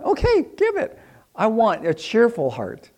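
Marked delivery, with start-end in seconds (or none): 0.0-1.0 high-pitched, playful go-ahead; eager acceptance. Retold or imitated. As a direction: give permission readily, pitched way up. God accepting a smaller gift.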